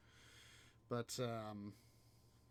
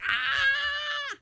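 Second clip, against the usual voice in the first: high squeal of delight; thrilled shriek; 13 dB louder than usual. Retold or imitated. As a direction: shriek with joy like the wife in the film. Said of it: "And she's like".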